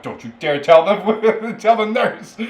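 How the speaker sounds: high, loud, slow laughing plea. Retold or imitated. Patient begging his sister through laughs, laughing plea. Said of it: "I'm like".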